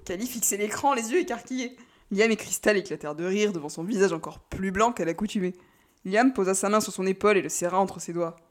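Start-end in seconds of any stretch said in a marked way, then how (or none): none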